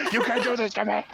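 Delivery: P=A; laughing mock outrage. Repeated, higher, laughing.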